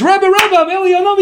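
a hint of a giddy crazy man tugging at the rabbi; eager madman.

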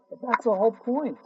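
ordinary talk

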